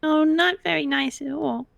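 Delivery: put-on a guilty child defending herself; wide-eyed little girl protesting; Missy the little girl. Player voices Missy.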